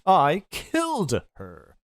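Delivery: a lightly voiced gloating villain, smug and unhurried; lightly voiced smug killer savoring the news; cruel Ace. Ace tells Snake he killed Clover, lightly voiced gloating.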